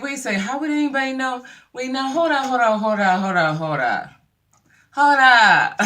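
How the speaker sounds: high, loud, rapid-fire hold-ons; imitating someone urgently stopping the conversation; mock frantic interruption. Acted quote of startled interruption.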